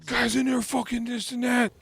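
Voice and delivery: high, loud manic rant; maniacal ranting. Retold or imitated. Acting out David's bathroom-door rant, loud and maniacal. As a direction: rave it loudly like a maniac. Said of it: "he's just fucking ranting maniacally".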